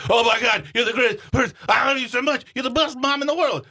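a dog swooning over its favourite person; gushing dog voice. Retold or imitated. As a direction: gush over Mom like a besotted dog. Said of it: "there'd be two voices".

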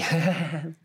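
mock polite giggle; little giggle. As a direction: soften it with a tiny laugh. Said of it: "giving a little, little giggle".

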